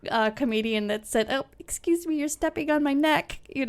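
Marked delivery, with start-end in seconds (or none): 1.3-3.4 high, meek apology; lightly put-on, high polite voice; mock polite complaint. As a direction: imitate a comedian's timid excuse-me. Quotes comedian's overly polite complaint.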